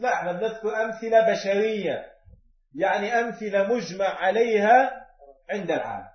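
ordinary talk, nothing marked

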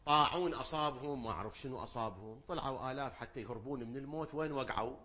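ordinary delivery

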